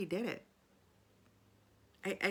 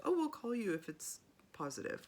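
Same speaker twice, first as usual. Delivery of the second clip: lightly higher, put-on reassuring voice; mock phone promise; 5 semitones higher than usual. Clinic staff telling a patient they'll call, lightly imitated. Quoting what they tell you.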